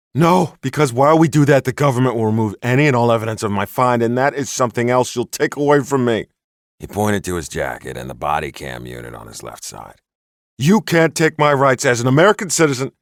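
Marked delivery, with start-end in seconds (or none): 0.1-6.3 high, loud, indignant enthusiast voice; a worked-up crank laying down his proof; excitable Bigfoot hunter. Acted. Bigfoot hunter warns the sheriff he saw everything, high indignant enthusiast. 10.6-12.9 high, loud, indignant enthusiast voice; a worked-up crank laying down his proof; excitable Bigfoot hunter. Acted. Bigfoot hunter warns the sheriff he saw everything, high indignant enthusiast.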